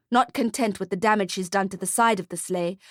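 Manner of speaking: usual speaking voice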